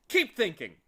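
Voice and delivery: loud stern scolding. Acted. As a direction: scold him sharply. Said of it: "scolded the watchdog".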